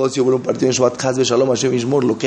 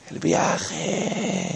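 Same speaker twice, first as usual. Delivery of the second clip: soft, hushed travel fantasy; imitated wistful longing, softer; mock dreamy; 9 dB quieter than usual. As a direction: breathe it out like a daydream.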